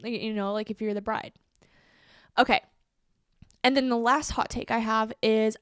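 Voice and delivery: usual speaking voice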